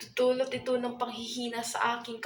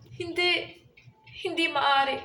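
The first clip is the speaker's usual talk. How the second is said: higher-pitched, dismayed protest; distressed refusal; 4 semitones higher than usual. Acted. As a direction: refuse it in dramatic disbelief. Refuses the arranged marriage. (madulang pagtanggi)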